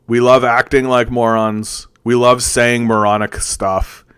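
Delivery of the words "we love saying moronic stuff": ordinary delivery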